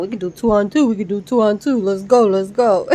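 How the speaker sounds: lightly voiced taunting brother; a hint of the brother egging on a fight; mild mock yell. Vlogger retells the brother yelling for a fight, mild mock taunt. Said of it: "the brother starts yelling".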